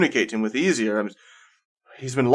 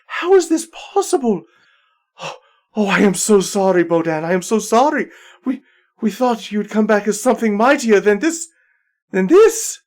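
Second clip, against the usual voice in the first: much higher, pleading; tearful apology; 9 semitones higher than usual. Acted. Saradwin apologizing to reborn Bodan, high and emotional. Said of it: "she picks him and goes".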